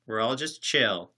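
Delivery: ordinary delivery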